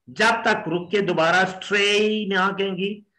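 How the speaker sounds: ordinary talk, nothing marked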